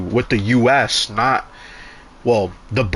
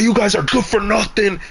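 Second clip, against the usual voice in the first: higher-pitched mock scorn; imitating angry voter; 9 semitones higher than usual. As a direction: toss out the insult like the furious voter. Angry voter insults poll workers.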